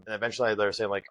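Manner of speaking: ordinary delivery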